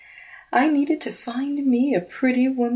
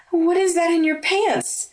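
shocked; 9 semitones higher than usual. Acted. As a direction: blurt it out astonished. Ashley shocked at the bulge.

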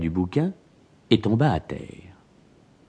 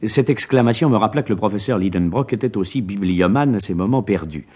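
plain reading voice

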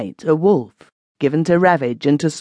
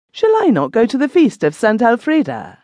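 higher-pitched, questioning cry; surprised protest; 8 semitones higher than usual. A narrator's reading. Isolde answers Harold's plea to miss the feast, surprised protest. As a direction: exclaim it high in mild dismay. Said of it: "cried Isolde".

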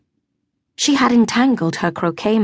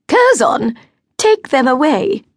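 high, loud order to a servant; haughty lady giving an order; commanding; 7 semitones higher, 5 dB louder than usual. A narrator's reading. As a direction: dismiss them without turning.

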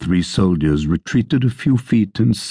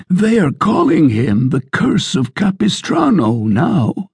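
landlord; 11 semitones higher than usual. Acted. Landlord quote.

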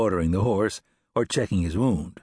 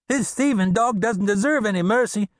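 higher, spitting contempt; disgusted; 12 semitones higher, 3 dB louder than usual. A narrator's reading. Hothead bounty hunter sneering at the captive, disgusted. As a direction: sneer at the outlaw. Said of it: "said Dinsmore with disgust".

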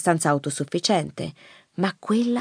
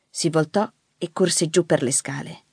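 plain reading voice

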